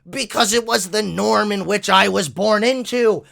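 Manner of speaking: over-the-top mocking tantrum; mock screaming. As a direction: imitate a hysterical opponent shouting an excuse. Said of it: "and start screaming".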